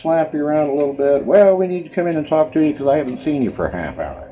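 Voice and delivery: hammed-up cheerful nurse checking in; mock nurse voice. Mocking hospital staff waking him.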